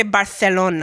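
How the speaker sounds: Barcelona with a lisped c, said Barthelona; Spanish lisp. Shown as a demonstration. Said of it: "We have the little lisp".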